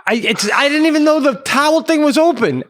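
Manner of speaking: high, loud, indignant comeback; sarcastic yelling. Retold or imitated. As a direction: holler it across the pool. Acted shouting match over towels.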